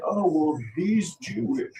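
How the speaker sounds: high-pitched mock complaint; mocking mimicry. Streamer mimics what people will say, mocking and high.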